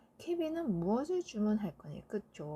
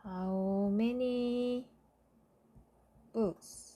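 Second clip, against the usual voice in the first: slow dictation; much slower than usual. Shown as a demonstration. Teacher dictating answer slowly.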